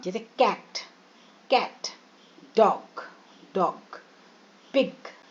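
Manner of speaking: teacher modelling short vowels in कैट, डॉग, पिग; short vowel demo. Shown as a demonstration. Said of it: "short vowels कहते हैं".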